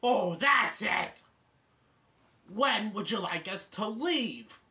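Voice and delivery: mock-indignant, punching it out; fed-up retort. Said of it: "And then Dupper says".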